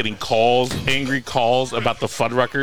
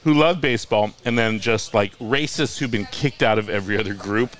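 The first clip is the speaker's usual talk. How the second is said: normal talking voice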